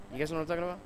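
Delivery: ordinary talk, nothing marked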